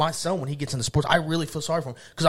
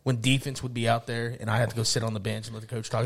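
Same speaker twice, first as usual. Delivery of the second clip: ordinary talk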